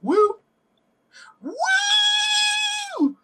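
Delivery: high falsetto woo shout; man doing a woman's voice; Woo Lady impression. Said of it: "I'm doing my Woo Lady impression".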